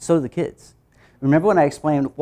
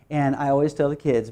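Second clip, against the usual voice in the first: ordinary talk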